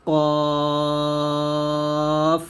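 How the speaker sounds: "কফ" from the tongue root, long held vowel; back-of-tongue Q. Shown as a demonstration. Teacher demonstrating qaf from the tongue root, prolonged. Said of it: "কফ উচ্চারণ করব".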